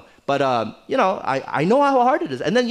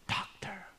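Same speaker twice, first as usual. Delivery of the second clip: much softer whispered "Doctor"; whispered hint; 4 semitones higher, 16 dB quieter than usual. Shown as a demonstration. Parent hinting at a career to the child, whispered. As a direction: breathe "Doctor" as a pointed hint. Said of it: "but you sigh".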